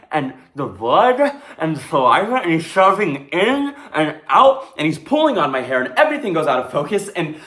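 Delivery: loud, high, building to a near cry; mounting distress. Acted. Victim reliving an attack, mounting distress. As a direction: let the panic climb line by line.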